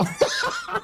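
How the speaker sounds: very high squeal of laughter; shrieking laugh. Real, not acted. Caster watching another failed attempt, squealing laugh. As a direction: let out a sharp squealing laugh. Caster loses it mid-cast.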